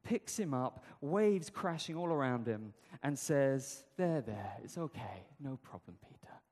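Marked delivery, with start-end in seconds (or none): 4.0-6.4 mock soothing. No, he doesn't.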